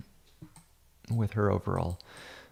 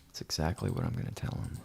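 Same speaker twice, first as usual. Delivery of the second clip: fast, soft unvoiced aside; hushed aside; 4 semitones lower, 8 dB quieter, faster than usual. Real, not acted. To viewers about the photographer, a fast hushed aside. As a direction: confide it under the breath.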